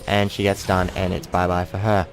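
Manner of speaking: usual speaking voice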